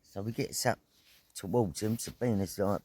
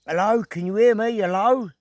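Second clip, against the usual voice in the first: loud, raised retold call; 10 semitones higher, 8 dB louder than usual. Rider to person lying in road, calling out. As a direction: call out to check they can hear.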